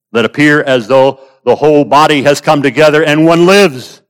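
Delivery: emphatic preacher, voice lifted; forceful preaching. Real, not acted. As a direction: press the point home to the congregation.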